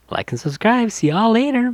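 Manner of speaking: very high, chirpy sign-off; sing-song cheery goodbye to fans; bubbly vlogger outro. Retold or imitated. Cartoon vlogger signing off to her bubblers, very high and perky. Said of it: "Lizzie Bubbles ... being like".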